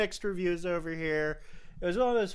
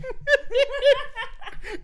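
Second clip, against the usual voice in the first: loud high delighted laugh; squealing amused laugh; high burst of laughter; 7 dB louder than usual. Real, not acted. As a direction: crack up at the screen. Laughing at the waveform.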